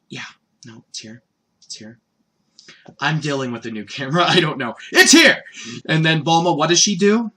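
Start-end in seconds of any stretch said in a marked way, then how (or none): 0.1-2.0 low, very soft mumble to self; hushed and low; muttered aside. Real, not acted. Muttered aside about new camera. 4.9-5.4 sudden high, loud call; abrupt shouted exclamation; loud announcement. Real, not acted. Announces it to the camera.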